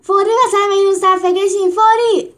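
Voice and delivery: high furious screech at the animals; angry trainer screaming the order to line up; angry shriek. Acted. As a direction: shriek the orders at them, rising. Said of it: "سپتیموس عصبانی جیغ زد".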